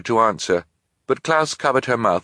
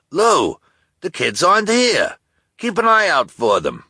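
high, loud call across aisles; 10 semitones higher, 5 dB louder than usual. Acted. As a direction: shout to Lou far away. Shopkeeper calls across store.

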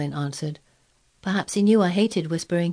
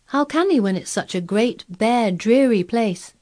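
scornful; 5 semitones higher, 4 dB louder than usual. A narrator's reading. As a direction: drag out dreary with disdain. Sickly boy scorns the moor.

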